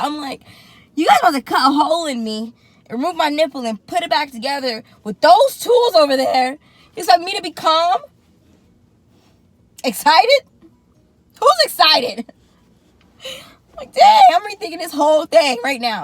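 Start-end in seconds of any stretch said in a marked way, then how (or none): 0.9-12.3 loud, high protest; incredulous outburst. Retold or imitated. Patient asked if she's excited, loud incredulous retort. Retold outburst at surgical staff. 13.8-16.0 loud, high wail of second thoughts; acted-out panic. Patient rethinking the whole thing, panicky wail. Retold panic before surgery.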